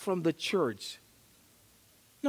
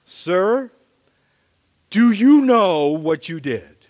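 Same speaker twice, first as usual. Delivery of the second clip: louder, firm police officer voice; louder stern traffic cop; 9 dB louder than usual. Retold or imitated. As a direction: confront the driver with authority. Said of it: "she says".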